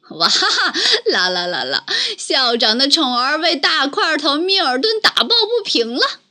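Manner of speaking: 拖长怪声的嘲笑大笑; 哈哈笑着挖苦人; jeering laughter. Acted. (嘲弄地大笑)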